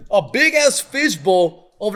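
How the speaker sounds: ordinary delivery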